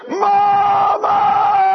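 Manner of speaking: very high, loud scream of Mama; falling scream. Retold or imitated. Eaglet shaken off his mother's back, screaming Mama. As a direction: scream as you are shaken off. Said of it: "the last thing she hears is".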